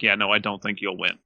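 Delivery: ordinary talk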